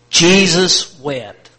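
each word drawn out slowly and loudly; slow, loud group recital. Shown as a demonstration. Preacher leading the church in reciting, slow and loud. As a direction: lead the room through it, slow and loud. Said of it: "One, two, three, together".

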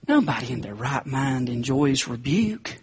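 ordinary talk, nothing marked

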